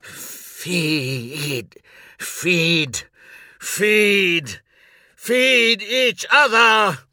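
pleading call building to a high roar; desperate roar. A narrator's reading. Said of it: "I roared and struggled".

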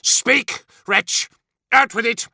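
loud, high, barked order; explosive, contemptuous shout; restored rage. A narrator's reading. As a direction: demand an answer furiously. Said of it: "his rage restored".

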